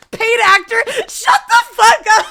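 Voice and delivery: high, loud laughing shout; shouting through laughter; laughing yell. Real, not acted. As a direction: yell at chat while cracking up. Mock yell at chat.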